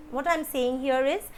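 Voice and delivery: normal talking voice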